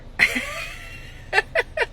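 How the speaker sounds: sudden high giggle; high laugh. Real, not acted. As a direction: laugh at yourself. Laughs after own remark.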